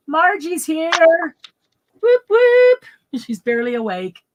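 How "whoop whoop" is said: high, loud whoop whoop; excited cheer. Real, not acted. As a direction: cheer like a hype host. Cheering a viewer arrival.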